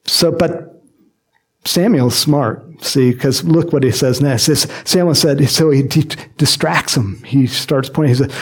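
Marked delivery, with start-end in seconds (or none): none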